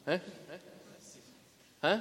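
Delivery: ordinary talk, nothing marked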